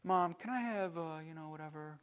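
put-on soft, nicely put child's request to mom; very quiet, tentative little request; gentle asking. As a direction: ask gently, barely raising your voice. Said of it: "Just gently, you come nicely and gently".

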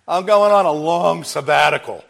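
loud, mock-grand announcement; lightly hammed-up quote, played for laughs; joking Jesus on holiday. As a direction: crow it as a joke. Jokingly voices Jesus off on vacation.

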